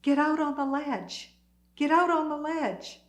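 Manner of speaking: calling out. Retold or imitated. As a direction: call across to a neighbouring window. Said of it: "I heard two voices calling".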